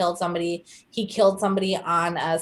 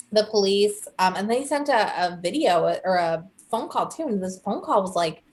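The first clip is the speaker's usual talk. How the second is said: ordinary talk, nothing marked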